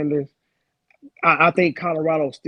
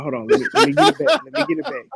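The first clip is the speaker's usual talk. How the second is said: flustered rush; 9 semitones higher, 3 dB louder, much faster than usual. Real, not acted. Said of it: "losing my train of thought".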